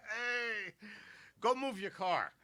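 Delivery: high, calling out across the street; mock yell. Comedian calling out to a driver, mock yell. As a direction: shout at the guy to move his car.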